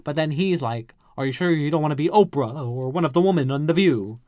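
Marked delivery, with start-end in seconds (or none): 1.2-4.2 louder, posh mock headmaster; hammed-up condescending question; shady condescension. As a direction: throw shade, loud and snooty. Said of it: "Trying to be shady or something".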